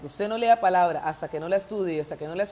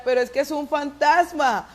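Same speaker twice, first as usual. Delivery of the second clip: much higher, louder frightened insistence; panicked disciple; 8 semitones higher, 6 dB louder than usual. Acted. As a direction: insist in alarm it's a ghost. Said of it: "Y el discípulo decía".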